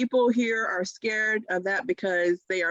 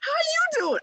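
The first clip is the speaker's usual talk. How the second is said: high, bright social butterfly hello; bubbly greeting. Shown as a demonstration. Demonstrating to listeners how to work a room, high excited greeting. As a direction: greet the room like you're on fire. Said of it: "Work the room".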